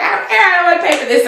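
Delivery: high, petulant refusal; Bethany's refusal. Retold or imitated. Bethany refuses to pay Carol for handkerchiefs, high and petulant. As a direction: whine it like a stubborn kid. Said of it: "She's like".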